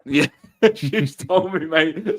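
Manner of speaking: chuckling through the words, slowed; amused, laughing while owning up. Real, not acted. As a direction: laugh it off, caught out by the wife. Laughing about wife's reminder.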